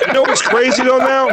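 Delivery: high, overhyped open-mic setup; imitating a bombing comedian; hack segue. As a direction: push it way up as a mock comic.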